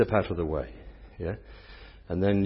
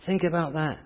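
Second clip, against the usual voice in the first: ordinary delivery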